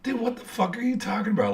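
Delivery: incredulous protest. Retold or imitated. Him answering the guard's threat, incredulous protest. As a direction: act out a baffled comeback.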